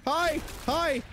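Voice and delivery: high, sudden hi; high-pitched greeting. Pretend. Player greets the waking regenerator, high-pitched.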